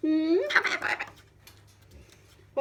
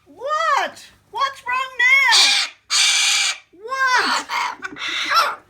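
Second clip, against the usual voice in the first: alarmed; 8 semitones higher, 6 dB louder, much slower than usual. Real, not acted. Pet suddenly alarmed.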